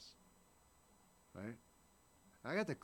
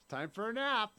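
parent voice; 7 semitones higher, 5 dB louder than usual. Retold or imitated. Parent putting toddler to bed.

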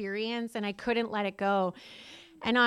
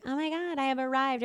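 higher, lightly parodied rapture; mock gushing; 4 semitones higher, faster than usual. Denying she came to AA delighted, mock rapture. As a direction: gush it in a raised pitch, insincerely.

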